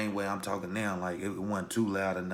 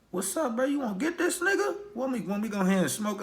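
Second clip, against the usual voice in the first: pitched way up, eager and egging him on; hyped-up friend; 13 semitones higher than usual. Retold or imitated. Friend from Chicago urging him to jump the OG, high hyped voice. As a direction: play the jumpy homie itching to start something.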